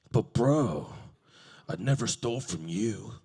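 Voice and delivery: deep, loud bro voice; stalker voice. Retold or imitated.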